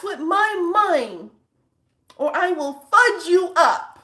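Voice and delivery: high, loud, slow prissy telling-off; mock scolding. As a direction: scold a monster slowly with big, drawn-out emphasis. Feeble scolding of a xenomorph, acted.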